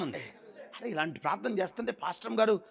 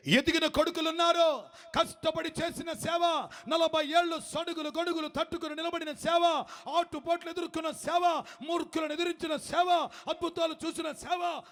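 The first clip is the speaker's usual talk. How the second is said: high, shouted sermon build; fiery preaching; 13 semitones higher than usual. Acted. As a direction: thunder it out to the congregation. Preaching at full force.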